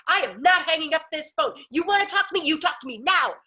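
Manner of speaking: high-pitched, insistent mock tirade; imitated angry screaming. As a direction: demand it like an irate landlord. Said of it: "he's proceeding to scream at me".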